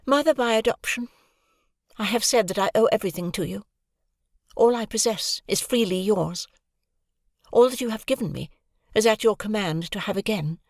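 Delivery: cool composed daughter pleading her case; Estella, cool and controlled. A narrator's reading. Estella calmly answering her adoptive mother.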